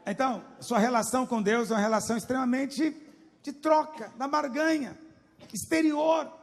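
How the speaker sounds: loud, climbing pulpit denunciation; rising shout. Real, not acted. Preacher denouncing a faith of bargaining to the church.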